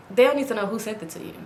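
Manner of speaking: usual speaking voice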